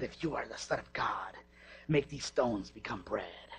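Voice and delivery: low, slow sinister tempting; satanic voice. Acted. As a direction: lure him in like Satan. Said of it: "That's my satanic voice".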